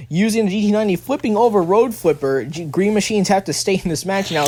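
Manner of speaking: usual speaking voice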